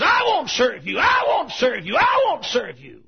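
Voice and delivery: high, loud, repeated refusal; girl shouting her refusal; defiant shout. Acted. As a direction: throw it back at him each time.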